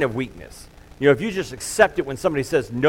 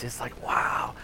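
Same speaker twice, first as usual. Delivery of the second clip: awed whisper; 2 semitones lower, 9 dB quieter, slower than usual. Real, not acted. Awed at the song.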